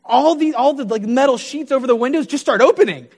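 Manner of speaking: high, loud, rushing wonder; amazed excitement. Retold or imitated. Preacher recounts windows suddenly opening, amazed and excited. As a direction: voice jumps up in excitement.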